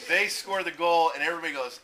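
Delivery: ordinary delivery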